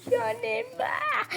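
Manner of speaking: hammed-up slow crying whine, going high; fake-cry it, dragging the words; mock sobbing.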